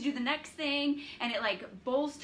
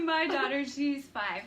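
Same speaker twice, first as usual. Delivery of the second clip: usual speaking voice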